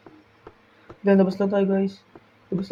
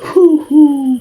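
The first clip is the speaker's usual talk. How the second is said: startled cry; 10 semitones higher, much slower than usual. Real, not acted. Jump scare in game.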